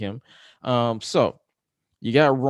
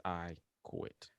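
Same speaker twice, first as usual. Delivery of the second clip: very soft, drawn-out "I quit"; hushed defeated quit; 7 semitones lower, 20 dB quieter than usual. Retold or imitated. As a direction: let it fall almost silent. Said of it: "those dreaded words".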